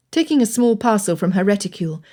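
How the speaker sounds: ordinary delivery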